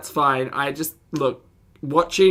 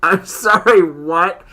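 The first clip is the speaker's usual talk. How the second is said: disbelief; 3 semitones higher, 10 dB louder, much slower than usual. Real, not acted. Reacting to whispering-mouths story.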